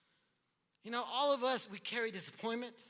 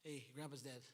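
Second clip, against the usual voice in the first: flat, offhand bad news; mock his own tactless delivery; blurting out a death to his wife, blunt and offhand; blunt insensitive; 9 semitones lower, 17 dB quieter than usual. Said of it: "saying it like that".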